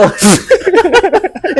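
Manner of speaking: high burst of laughter; laughing. Real, not acted. Host cracking up at the popok story, high laughter. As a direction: break up laughing.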